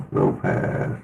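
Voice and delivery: muffled low-pass filter on the voice. Shown as a demonstration. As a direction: sound like it's through a wall, low pass. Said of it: "Really low pass".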